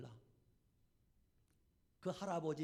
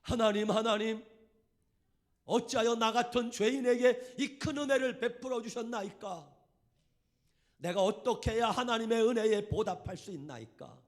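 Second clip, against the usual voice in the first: loud, much higher, pleading; crying prayer; 6 semitones higher, 13 dB louder than usual. Retold or imitated. Leper man repeating his prayer aloud, tearful wailing. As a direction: cry the same words out again, begging. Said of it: "그 말만 하는 거예요".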